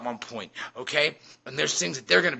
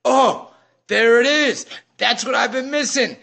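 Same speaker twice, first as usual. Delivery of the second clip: loud, high burst of sudden realization; aha moment; 7 semitones higher, 6 dB louder, slower than usual. Acted. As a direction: cry it out like you finally found it. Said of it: "that aha moment people talk about".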